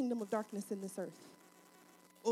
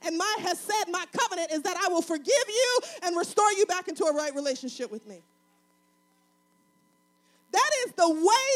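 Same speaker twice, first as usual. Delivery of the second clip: raised, emphatic preacher's delivery; full-force preaching; 6 semitones higher, 9 dB louder than usual. Real, not acted. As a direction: drive it home to the congregation, building in force.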